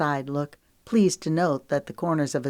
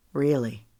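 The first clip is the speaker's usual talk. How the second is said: sincere really; 2 semitones lower than usual. Acted. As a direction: answer firmly to reassure her. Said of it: "searching his face".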